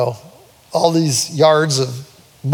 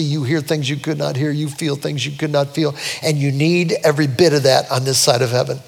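normal talking voice

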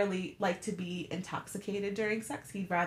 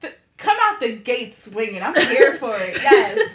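excited cheer; 2 semitones higher, 10 dB louder than usual. Real, not acted. Cheering friend's bold answer.

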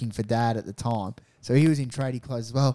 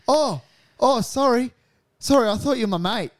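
flustered apology; 9 semitones higher, 4 dB louder than usual. Retold or imitated. Acting the kid's embarrassed apology.

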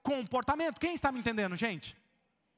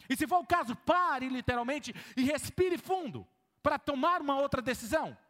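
usual speaking voice

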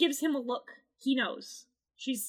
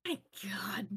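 whispered relief; 6 semitones lower, 12 dB quieter than usual. Real, not acted. Relief after tension.

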